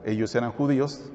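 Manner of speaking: normal talking voice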